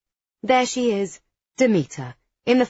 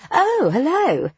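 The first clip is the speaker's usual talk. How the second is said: high, drawn-out, swooping hello; gracious, slow sing-song surprise; surprised greeting; 10 semitones higher, 4 dB louder, slower than usual. A narrator's reading. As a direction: greet someone you've only just noticed. Demeter notices the narrator, greets her.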